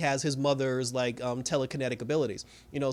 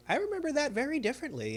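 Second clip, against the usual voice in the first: high-pitched imitated woman's voice; Wanda; 9 semitones higher, 4 dB quieter than usual. Sister disputing her brother's childhood memory, imitated high voice. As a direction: lift the pitch well up to quote her. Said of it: "And then Wanda was just like".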